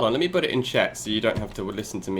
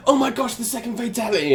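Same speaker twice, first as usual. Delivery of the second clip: excited fan; 9 semitones higher than usual. Retold or imitated. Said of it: "everybody just posting on my Twitter".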